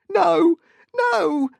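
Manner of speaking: loud, very high panicked cries; begging. A narrator's reading. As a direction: cry out in terror, begging. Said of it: "he begged".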